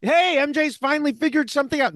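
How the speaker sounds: high-pitched, hopeful cheer; mock excitement. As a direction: act out sudden delighted hope. Acting out the viewers' raised hopes.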